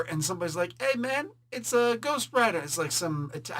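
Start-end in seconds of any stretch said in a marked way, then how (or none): 0.8-2.6 high-pitched hint of an Italian guy. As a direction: lightly imitate an Italian street guy, pitched up. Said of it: "supposed to be some Italian guy".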